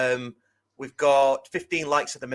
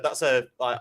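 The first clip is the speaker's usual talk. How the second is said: ordinary talk, nothing marked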